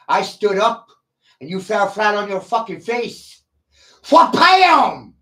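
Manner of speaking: triumphant boast. Real, not acted. Streamer gloats over his silent chat, shouting. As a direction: slam it home like a knockout.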